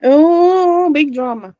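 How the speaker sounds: high, loud teasing "oh"; mock surprise. Real, not acted. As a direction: gasp it like a soap opera. Mock gasp at "big drama.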